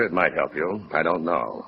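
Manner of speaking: low, quiet and defeated; a hint of discouragement, trailing away; discouraged. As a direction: let the hope drain out of it. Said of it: "you sound discouraged".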